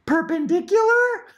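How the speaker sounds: very high squeaky toy doll voice; annoying talking doll. Acted. Mom imitating an annoying talking doll, very high. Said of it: "annoying Dora doll".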